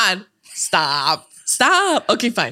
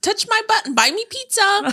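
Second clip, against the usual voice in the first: much higher girly put-on voice; pick-me girl voice; 13 semitones higher than usual. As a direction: play a silly pick-me girl. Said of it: "We say it in that voice though".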